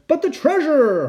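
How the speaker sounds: high-pitched storybook holler; Braidbeard hollering. A narrator's reading. Pirate protesting over the treasure, high holler. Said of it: "hollered Braidbeard".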